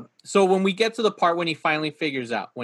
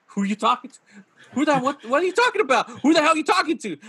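higher-pitched incredulous questions, building; mock bewildered outburst; 9 semitones higher, 3 dB louder, much faster than usual. Imitating the husband's reaction to his fake wife, incredulous outburst. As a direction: imitate a man baffled by a sudden sales pitch. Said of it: "I love his reaction where he's just like".

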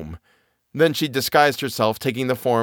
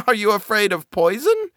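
put-on high cackly old woman; sly crone, playing nice; old peasant woman; 10 semitones higher than usual.

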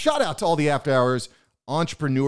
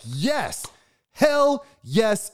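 excited cheer; 9 semitones higher, much slower than usual. Retold or imitated. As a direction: whoop it out like celebrating a win. Retelling his own excited reply.

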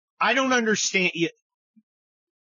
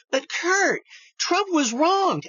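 much higher, whining protest; high nasal-free mock whine of an objector; mock whiny objector; 11 semitones higher, much slower than usual. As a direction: parody a complaining fan cutting in. Mock objection from imagined critic.

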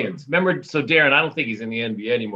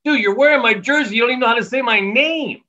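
high, loud indignant protest; exasperated comeback; 13 semitones higher, 5 dB louder than usual. Retold or imitated. Player corrects a fan wearing his jersey, exasperated. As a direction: act out an exasperated retort, pitched way up.

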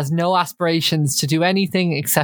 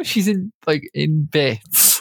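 normal talking voice

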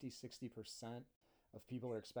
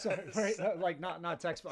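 laughing while protesting; 7 semitones higher, 10 dB louder than usual. Real, not acted. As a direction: laugh through it, louder.

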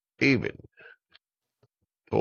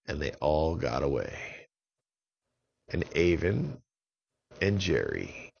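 ordinary delivery, nothing marked

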